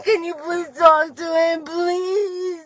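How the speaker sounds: slow, tearful sobbing pleas; crying and begging between sobs. Real, not acted. Sobbing in police car.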